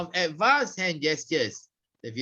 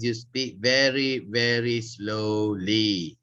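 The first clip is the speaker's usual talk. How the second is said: slow, drawn-out, lower words; 8 semitones lower than usual. Shown as a demonstration. Trainer showing the class speaking rate, very slowly. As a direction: stretch out each word slowly. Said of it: "Rate refers to your speaking rate".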